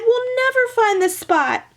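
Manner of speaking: put-on high despairing girl voice; high girl's lament; dismayed cry. A narrator's reading. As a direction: cry out in frustration. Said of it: "cried Meg".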